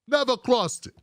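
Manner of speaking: ordinary delivery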